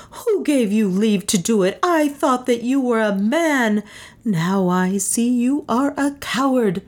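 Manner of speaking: high, accusing woman's voice; acted outrage, pitched high; indignant. A narrator's reading. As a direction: accuse him with scorn. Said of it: "stood over him with flashing eyes".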